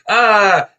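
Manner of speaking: short, high, loud cry of pain; mock scream. Reenacted man hit by bees, sudden high yelp. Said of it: "he's like".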